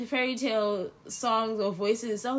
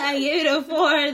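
laughing through the words; giggling as she says it; 5 semitones higher, 7 dB louder than usual. Real, not acted. Repeats her rating while laughing.

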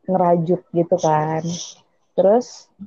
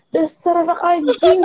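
high-pitched playful cursing; mock insult; 8 semitones higher than usual. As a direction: hurl a joke insult in a raised pitch. Acted insult in retold quarrel.

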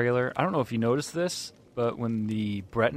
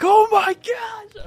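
loud, high, drawn-out excited scream; 8 dB louder, much slower than usual. Retold or imitated. Gamer reliving the Bone Colossus reveal, excited scream. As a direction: scream it like an overwhelmed fan.

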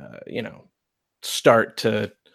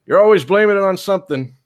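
put-on higher, steady old lawman; old marshal; 9 semitones higher, 6 dB louder than usual. Old marshal facing down the preacher, firm and high. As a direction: calmly overrule the preacher, slowing at the end.

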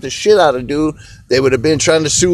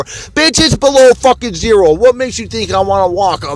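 much higher, louder angry yell; angry outburst; 6 semitones higher, 5 dB louder than usual. Real, not acted. Ranting smoker yells at complainers, angry outburst. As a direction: blow up at them.

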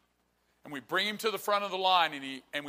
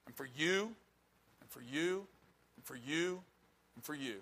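soft, intimate repeated phrase; quietly single out each person, fading at the last; hushed personal appeal; 10 dB quieter than usual. Real, not acted. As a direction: drop the volume and offer it to each listener. Preacher addresses listeners one by one.

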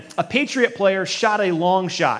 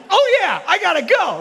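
high, loud sudden realization; startled realization; 13 semitones higher, 4 dB louder than usual. Retold or imitated. Player startled by coach's yell, high surprised reply. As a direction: jolt into action.

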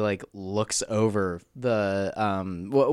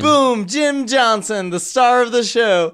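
loud, high mock fanfare; hype announcer; 10 semitones higher, 6 dB louder, slower than usual. Cohost introducing the show's star, excited announcer shout. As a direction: trumpet the star's name to the crowd.

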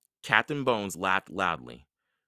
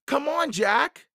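high, slow, loud call; shouting; 6 dB louder than usual. A narrator's reading. Annie shouts down to Jack from above, urgent. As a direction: shout to hurry someone along. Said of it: "Annie shouted".

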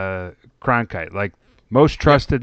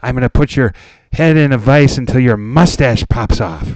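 loud, menacing prank call; crank caller voice; 3 semitones higher, 7 dB louder than usual. Retold or imitated. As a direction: threaten him like a crank caller. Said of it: "a crank caller".

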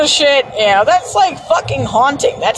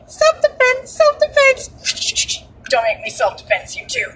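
high, squeaky anime voice on the first words; anime character quote; 13 semitones higher, 3 dB quieter than usual. Retold or imitated. Friend quoting a favorite anime line, in a high squeaky anime voice. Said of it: "my absolute favorite freaking line".

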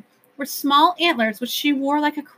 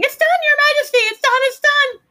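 tiny high creatures screaming, louder; screaming Jiffies; 5 dB louder, faster than usual. A narrator's reading. Jiffies telling the king the news, high squealing screams. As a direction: squeal it out in triumph. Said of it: "The Jiffies were screaming".

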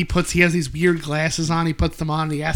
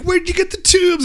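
high, raised-voice call; mock yelp, pitched way up; imitated frantic yell; 12 semitones higher, 4 dB louder than usual. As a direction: holler it like someone floundering in a pool. Quotes man floundering in pool.

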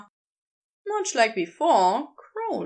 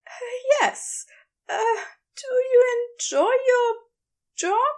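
higher, wavering and flustered; nervous fluster; 12 semitones higher, 3 dB louder than usual. Acted. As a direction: stammer it out nervously. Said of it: "gave a nervous chuckle".

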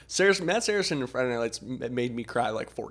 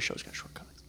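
whispering; 15 dB quieter than usual. Real, not acted. Aside in a whisper.

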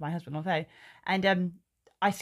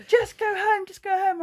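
much higher, louder pleading call; Tim's urging; 13 semitones higher, 5 dB louder than usual. Retold or imitated. Husband urges his furious wife to go home, high and loud. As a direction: beg her to leave it, pitched up. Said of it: "And Tim's going".

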